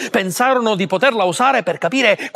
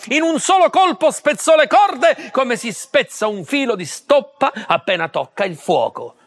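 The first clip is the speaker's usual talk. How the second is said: ordinary delivery, nothing marked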